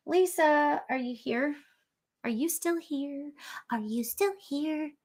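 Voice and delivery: high, soft, silly sing-out; funny voice. Pretend. Host checking if Lisa is still there, funny voice. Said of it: "I used to make these funny voices".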